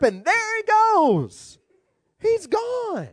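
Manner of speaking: high, drawn-out exclamation; excited climax. Real, not acted. Preacher telling how the whale burped Jonah out, excited high call. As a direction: sail up high and stretch it out.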